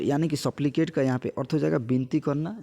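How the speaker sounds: usual speaking voice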